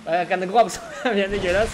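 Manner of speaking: usual speaking voice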